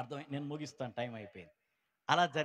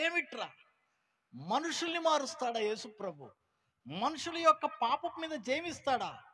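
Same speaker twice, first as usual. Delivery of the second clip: amazed disbelief; 12 semitones higher, 8 dB louder than usual. Retold or imitated. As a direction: marvel aloud in disbelief. Father amazed at the testimonies. (ఆశ్చర్యంతో గట్టిగా)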